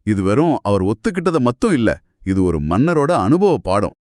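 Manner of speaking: usual speaking voice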